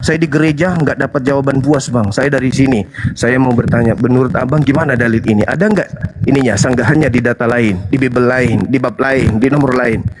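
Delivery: a lightly voiced humble asker, pitched low; polite questioner. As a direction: play a meek visitor asking politely. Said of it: "Anda naik ke sini bertanya".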